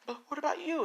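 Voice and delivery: put-on a kid afraid for her; worried boy. Izuku asks the woman about her safety, high and worried.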